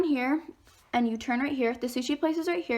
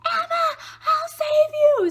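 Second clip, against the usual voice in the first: very high, loud mock scream; hammed-up heroic shriek; imitated screaming; 7 dB louder than usual. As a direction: call out shrilly as the rescuing friend. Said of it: "He runs out there screaming".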